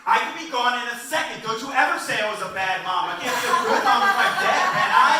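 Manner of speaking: hammed-up guilt-tripping mom; shrill mom lecture. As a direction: mom scolding her crying four-year-old in the theater. Mom lecturing kid after Bambi.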